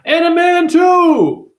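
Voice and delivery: shouted high, loud and slow; a stretched cartoonish yell; mock shrill shout. Mocking the character voices with a high slow shout.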